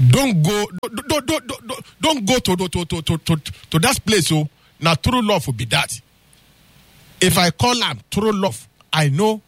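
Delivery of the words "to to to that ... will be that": usual speaking voice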